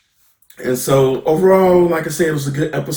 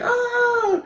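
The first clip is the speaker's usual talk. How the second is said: thin high-pitched pretend yell; mock frustrated yell. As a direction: imitate a scared, frustrated scream at half volume. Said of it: "and yells like".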